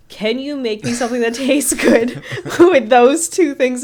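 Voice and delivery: amused, giggly imitation of a request; laughing retelling. Real, not acted. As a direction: laugh your way through the quote. Laughing through a retold request.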